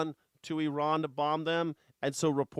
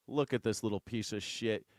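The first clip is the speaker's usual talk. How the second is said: ordinary delivery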